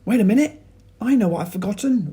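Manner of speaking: high, loud, fast eureka; sudden realisation. A narrator's reading. George remembers the missing item, high and quick. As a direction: blurt it out as it comes to you.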